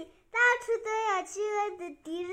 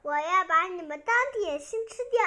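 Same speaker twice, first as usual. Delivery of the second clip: plain reading voice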